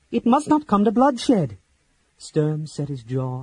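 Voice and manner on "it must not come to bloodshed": high, loud plea; pleading. A narrator's reading. Laurana begs Sturm to avoid bloodshed, high and pleading. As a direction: beg them to stop. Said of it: "she pleaded".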